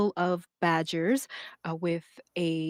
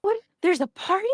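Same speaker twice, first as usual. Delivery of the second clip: put-on excited kid; 13 semitones higher, 5 dB louder, faster than usual. GM voicing hopeful kid.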